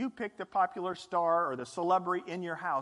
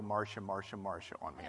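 Brady Bunch line; 11 semitones lower, 8 dB quieter, faster than usual. Retold or imitated. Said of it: "The Brady Bunch thing".

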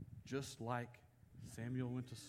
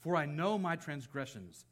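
raised, declaimed psalm with weighty pauses; scripture reading; 4 semitones higher, 5 dB louder than usual. Acted. Preacher reads David's psalm of repentance, declaimed plea. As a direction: proclaim the plea, louder and higher, phrase by phrase.

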